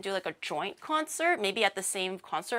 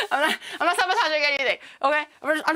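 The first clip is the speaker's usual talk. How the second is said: laughing through a high protest; giggly higher, louder denial; 6 semitones higher, 3 dB louder, much faster than usual. Real, not acted. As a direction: deny it while cracking up. Laughing denial after joke.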